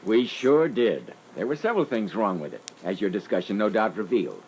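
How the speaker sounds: ordinary talk, nothing marked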